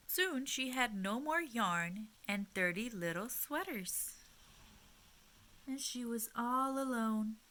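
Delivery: gentle, expressive storytime voice; reading to children. A narrator's reading. Teacher reading a picture book to little listeners, gently expressive. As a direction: read it like a picture book at bedtime.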